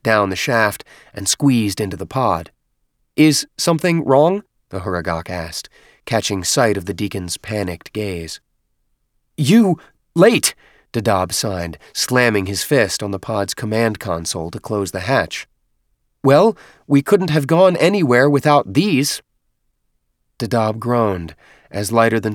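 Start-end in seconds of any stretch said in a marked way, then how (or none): 3.2-4.4 higher-pitched questioning character voice; questioning Huragok. A narrator's reading. Huragok questions panicked deacon in story. 9.4-10.5 high, loud, broken-speech character voice; agitated deacon. A narrator's reading. Panicked deacon scolds late companion. 16.2-19.2 higher-pitched character voice, protesting; self-justifying Huragok. A narrator's reading. Huragok justifies its delay in story.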